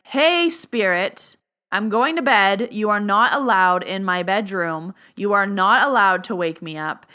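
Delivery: loud, emphatic command; stern boundary. Shown as a demonstration. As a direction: say it like you mean it, firmly. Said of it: "Say it like you mean it".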